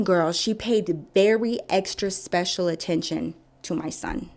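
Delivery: usual speaking voice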